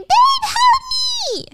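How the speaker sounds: very high, slow, crying scream; screaming for help. Acted. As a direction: shriek for help in tears. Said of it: "Stacy screaming".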